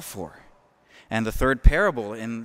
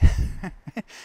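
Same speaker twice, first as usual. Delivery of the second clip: burst of laughter; 6 semitones higher, 4 dB louder than usual. Real, not acted. Laughs at his own joke.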